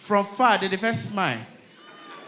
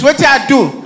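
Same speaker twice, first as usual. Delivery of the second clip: indignant driver; 3 semitones higher, 7 dB louder than usual. Retold or imitated. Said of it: "you go dey ask police".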